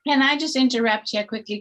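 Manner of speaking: usual speaking voice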